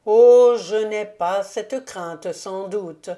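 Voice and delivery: a touch of sorrowful sarcasm; sad irony. A narrator's reading. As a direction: let the irony land with weary sadness. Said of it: "avec une triste ironie".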